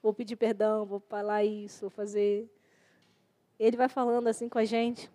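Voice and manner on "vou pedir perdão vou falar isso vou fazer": soft, resigned giving in; quietly conceding to the Lord; humble surrender. Retold or imitated. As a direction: meekly give in, voice fading. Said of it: "Aí você tem que falar assim".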